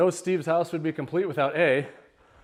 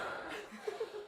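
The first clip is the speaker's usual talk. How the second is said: breathless laugh; 13 dB quieter than usual. Real, not acted. Breathless laugh after stairs.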